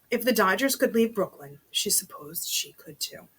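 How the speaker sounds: ordinary delivery, nothing marked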